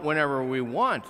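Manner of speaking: ordinary delivery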